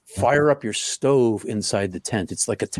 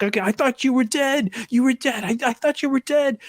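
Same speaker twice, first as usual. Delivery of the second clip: much higher, shaken relief; breathless relief after the scare; relieved panicked friend; 14 semitones higher than usual. Retold or imitated. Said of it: "and he helps me back".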